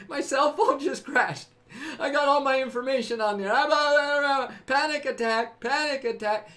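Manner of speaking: hammed-up panic over a crashed phone; mock panic. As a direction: rattle it off like someone losing it. Mocking a panicking person.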